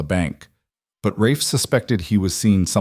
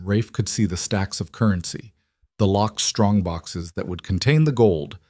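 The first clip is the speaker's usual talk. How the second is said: plain reading voice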